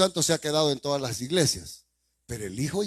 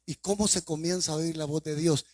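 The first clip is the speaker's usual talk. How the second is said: normal talking voice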